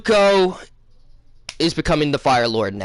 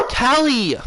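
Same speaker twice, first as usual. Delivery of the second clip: high, falling call of a name; real calling out; 10 semitones higher than usual. Real, not acted. Calling Callie about the interrupting dog, real exasperated call. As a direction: call to a sibling in the middle of recording.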